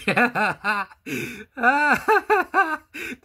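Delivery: high shrieking burst of laughter; a loud, helpless high laugh. Real, not acted. Laughing at the video.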